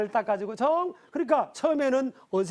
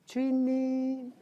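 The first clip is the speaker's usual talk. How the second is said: a pastor hams up Sarah's reverent "주인님" to Abraham, slow and laughing; 5 semitones higher, much slower than usual. Retold or imitated. The pastor imitates Sarah saying "주인님" to Abraham, slowly and with a laugh. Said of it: "이런 마음".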